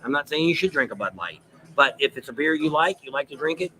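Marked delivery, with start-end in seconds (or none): none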